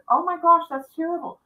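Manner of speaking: high-pitched dismayed concern; retold dismay, voice lifted high; shocked sympathy. Woman reacting to a lost child's plight, shocked sympathy.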